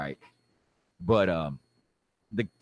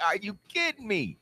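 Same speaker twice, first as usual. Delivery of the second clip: high-pitched comic disbelief; incredulous squeal; 12 semitones higher than usual. Acted. Man reacting to the officer arresting him, high incredulous squeal.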